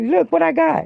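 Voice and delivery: high, loud gloating. Retold or imitated. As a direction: show off the winnings, loud and high. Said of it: "I was like".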